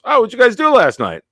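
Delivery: fake-friendly dad setting a trap; mocking dad. Friend's dad teasing the boys in the elevator, high sing-song.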